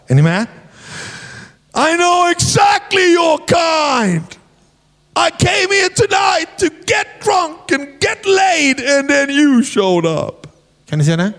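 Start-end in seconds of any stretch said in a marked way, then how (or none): 1.8-10.5 high, slow, slurring shout; drunk man's rant. Retold or imitated. Drunk Pentecostal at the door shouting at preacher. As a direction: rail at the preacher, drunk and wounded. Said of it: "sa så här".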